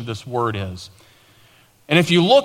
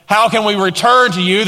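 raised, mock-innocent question; flippant retort; 7 semitones higher, 5 dB louder than usual. The people answering God back, imitated flippancy. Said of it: "asked another flippant response".

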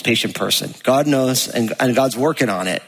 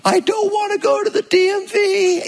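much higher, whining voice; whiny dread. Retold or imitated. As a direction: whine like a kid dreading the DMV. Dreading the DMV trip.

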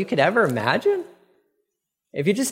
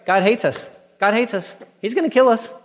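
guilt-ridden complaint; 7 semitones higher than usual. Retold or imitated. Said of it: "this guilt conscious, this guilt complex".

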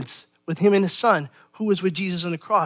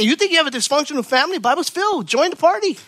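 higher, quick, joking; playful excitement; 9 semitones higher, 3 dB louder, faster than usual. Real, not acted. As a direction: tease the crowd, fast and high. Joke about dysfunctional families.

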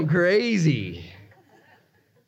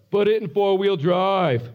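drawn-out mock yell of a bystander; slow, stretched-out retold shout; imitated yell; 3 semitones higher, slower than usual. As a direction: call it out slowly, imitating a passer-by's yell. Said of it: "and she yells".

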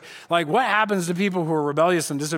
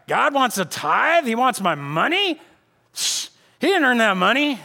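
scoffing; 6 semitones higher than usual. Retold or imitated. Mocking the stingy believer.

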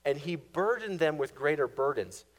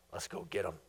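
much lower, different voice, flat command; Pharaoh's order; 10 semitones lower, 11 dB quieter, faster than usual. Acted. Preacher voicing Pharaoh rallying his army, low menacing order. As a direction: growl it as the scheming king.

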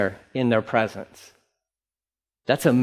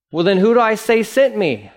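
higher, pressing question; anxious Moses; 8 semitones higher, 3 dB louder than usual. Acted. Moses pressing God for a name, higher and anxious. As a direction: push the question up, worried.

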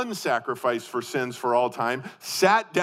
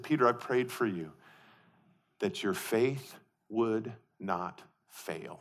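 soft, slow and lower, with quiet assurance; Jesus speaking tenderly; 5 semitones lower, 8 dB quieter than usual. Retold or imitated. Jesus promising Peter his faith won't fail, softly. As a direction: reassure him gently. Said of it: "He said".

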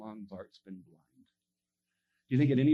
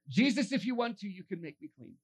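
higher, declarative plea without begging; statement of faith; 7 semitones higher than usual. Retold or imitated. Leper states his faith to Jesus, declarative. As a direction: assert it simply, falling away at the end. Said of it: "Just a statement of faith, not begging".

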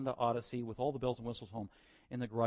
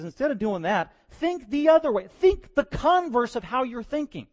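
much higher, louder voice of Paul; Paul's command; 10 semitones higher, 10 dB louder than usual. Retold or imitated. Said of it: "he says".

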